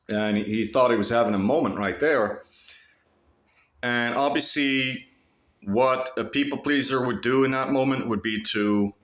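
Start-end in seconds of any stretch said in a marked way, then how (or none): none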